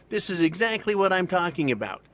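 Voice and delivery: imitating a mad heroine, half-serious; mock angry woman. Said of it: "finally she gets mad and goes".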